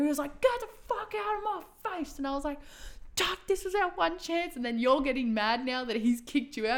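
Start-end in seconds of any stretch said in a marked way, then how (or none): 0.4-2.2 imitated angry outburst, high-pitched; high mock-furious dismissal; mounting anger. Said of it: "getting madder and madder". 3.2-4.6 high-pitched retold complaint; exasperated pleading. Retold pleading scolding in dream.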